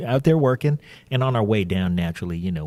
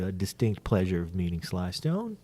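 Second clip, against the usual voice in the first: ordinary delivery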